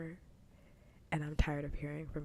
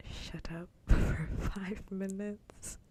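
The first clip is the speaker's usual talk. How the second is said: slow, louder, breaking into laughter; laughing exasperation; 3 semitones higher, 7 dB louder, much slower than usual. Real, not acted. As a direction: drag it out through a laugh.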